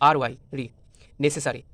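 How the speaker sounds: ordinary talk, nothing marked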